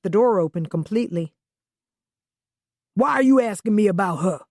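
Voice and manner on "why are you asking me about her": sharp, defensive Southern man; snapping, Southern accent. Acted. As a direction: snap it back in a Southern accent. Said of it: "he snapped".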